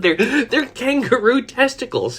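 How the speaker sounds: high-pitched, laughing confession; cracking up. Retold or imitated. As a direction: squeeze the words out slowly through giggles. Cracking up retelling own answer.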